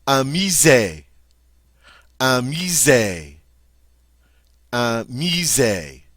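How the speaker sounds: "Un musée" slow, clear, repeated; teacher modelling "Un musée"; model French word. Shown as a demonstration. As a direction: drill "musée" slowly. Teacher models vocabulary words.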